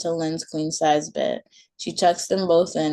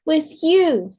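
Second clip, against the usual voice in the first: put-on high, louder child's cry; a toddler shrieking her demand; 11 semitones higher, 6 dB louder than usual. A narrator's reading. Maylee shrieking at her mom, high loud child voice. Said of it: "Maylee shrieks".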